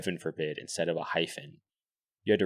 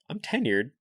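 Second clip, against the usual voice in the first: retold smug boast; 8 semitones higher than usual. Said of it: "He's like".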